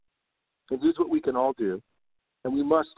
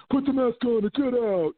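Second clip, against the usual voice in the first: higher, put-on commanding voice; bossy employee; 10 semitones higher than usual. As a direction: bark it as a mock enforcer. Employees ordering customers.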